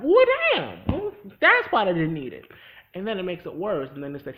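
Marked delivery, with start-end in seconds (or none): 0.0-2.4 much higher and louder outburst; mock shocked outburst. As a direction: blurt it out in disbelief. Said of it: "And I was like".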